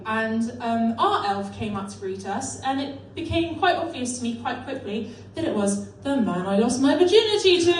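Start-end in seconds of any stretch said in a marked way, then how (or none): none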